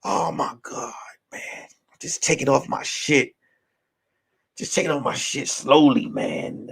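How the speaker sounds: hushed, panicked self-talk; panicked whisper. Retold or imitated. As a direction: mutter it in a low panicked hush. Retold panic while undressing.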